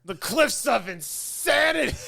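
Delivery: high, slow dramatic announcement; Princess Bride quote. Retold or imitated. Friend quotes Vizzini to her movie buddy, slow and grand. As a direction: proclaim it with mock grandeur.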